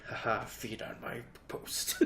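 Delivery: slow, quiet, smug; teen self smugly enjoying the sympathy; smug mock gloating. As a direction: savor it like a villain soaking up attention. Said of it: "I'd be sitting there like".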